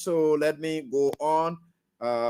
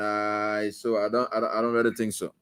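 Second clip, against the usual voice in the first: usual speaking voice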